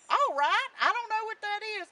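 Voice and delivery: high, loud, quick rooster voice; rooster character. Acted. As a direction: squawk it out fast and loud. Rooster answers Jack in story.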